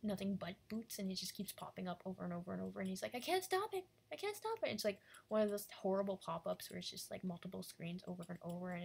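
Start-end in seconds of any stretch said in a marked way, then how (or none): 3.1-4.7 very high, loud panicked shriek; panicked cry. Acted. Infected robot flooded by boot pop-ups, screaming in panic. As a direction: yell it like you're losing control.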